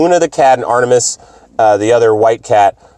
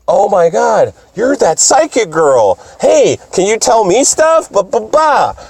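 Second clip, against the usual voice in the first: higher gushing fan voice; excited fangirl, pitched up; Mars; 8 semitones higher than usual. Retold or imitated. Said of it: "It's like".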